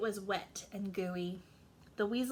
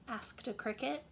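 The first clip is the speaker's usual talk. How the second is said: ordinary delivery, nothing marked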